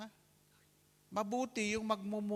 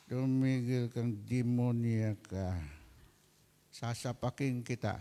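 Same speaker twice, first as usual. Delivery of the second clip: lower, slow mock threat from the husband; husband snapping back at his wife, lower and slow; gruff husband threat; 8 semitones lower than usual. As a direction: growl it back at the nagging wife, slowly.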